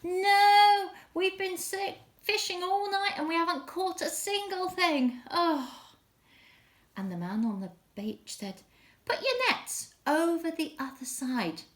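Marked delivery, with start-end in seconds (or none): 0.0-5.9 high, exasperated storybook call; shouting back. Acted. Tired fishermen answering the man on the beach, shouting back. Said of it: "And they shouted back". 9.1-11.6 high storybook call from shore. Acted. Man on the beach instructing the fishermen, calling out.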